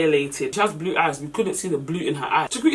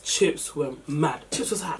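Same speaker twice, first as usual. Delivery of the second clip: ordinary talk, nothing marked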